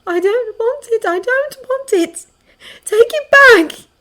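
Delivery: high, drawn-out anguished plea; desperate girl's high voice; distressed begging. Acted. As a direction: build from pleading to a loud cry.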